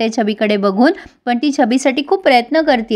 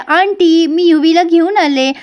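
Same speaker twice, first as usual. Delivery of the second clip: calling out; 5 semitones higher, slower than usual. Retold or imitated. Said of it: "म्हणजे ओरडतेय". (काकूंना मोठ्याने हाक मारत)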